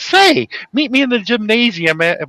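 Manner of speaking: high, slow put-on come-on; Curly impression. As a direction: open high and drawn out on "Say". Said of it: "he goes to the girl in front".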